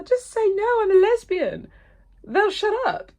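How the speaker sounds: high, loud mock outburst; imitating own insistent urging; retold exasperated advice. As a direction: blurt it out high and emphatic. Retold emphatic advice to daughter.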